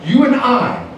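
high, emphatic appeal to the church; urgent preacher's cry; rising preaching. Real, not acted. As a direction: climb in pitch as you press the point. Preaching rises.

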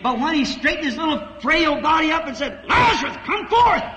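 rising, shouting sermon climax; preaching at full force. Acted. Preacher roaring to the congregation about God in man. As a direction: build the crowd up to a roar.

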